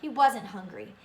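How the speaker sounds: plain reading voice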